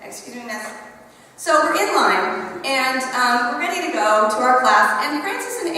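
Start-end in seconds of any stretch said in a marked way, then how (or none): 0.0-0.6 put-on quiet polite kid. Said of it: "she taps me".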